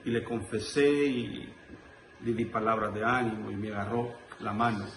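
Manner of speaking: normal talking voice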